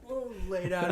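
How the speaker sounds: ordinary talk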